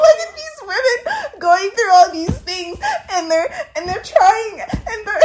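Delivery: high, voice breaking near tears; tearful. Real, not acted. Moved by the film's women, voice breaking with tears. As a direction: let the tears come into the words. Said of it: "You're gonna make me cry".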